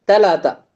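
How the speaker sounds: தலாத்தா with both th sounds turned to T; tongue tip strikes the palate edge in தலாத்தா; Egyptian T. Shown as a demonstration. Teacher showing Egyptian three, தலாத்தா with plain T. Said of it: "Egyptian எப்படி pronounce பண்ணுவாங்க".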